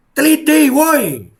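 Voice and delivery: high furious shout; yelling. Real, not acted. Demanding the government investigate, a real furious yell. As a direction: scream the demand at them. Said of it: "teriak gue".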